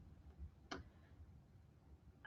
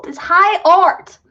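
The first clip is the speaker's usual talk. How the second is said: mock grandiose; 7 semitones higher, 5 dB louder, slower than usual. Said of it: "I'm kidding".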